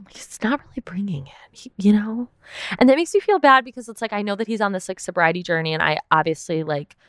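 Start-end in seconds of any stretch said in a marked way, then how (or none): 0.1-2.3 hushed, breathy confiding whisper; a secretive whisper to the viewers; whispered aside. Real, not acted. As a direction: lean in and whisper it like gossip. Confiding doubt about a castmate.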